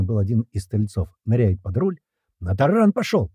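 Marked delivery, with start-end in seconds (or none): 2.4-3.3 high, urgent exclamation; excited shout. A narrator's reading. Fighter warning comrade of the ramming, high shout. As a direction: cry out a sudden sighting. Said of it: "закричал Слива".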